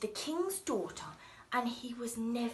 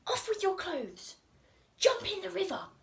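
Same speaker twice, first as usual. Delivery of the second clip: higher cat voice giving quick orders; bossy cat; 8 semitones higher than usual. Acted. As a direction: order him about like a cheeky cat. Cat orders young man.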